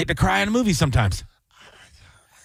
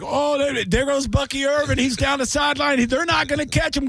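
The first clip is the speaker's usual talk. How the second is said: mock play-by-play; 10 semitones higher than usual. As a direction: call the run like a radio announcer. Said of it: "They'd be like".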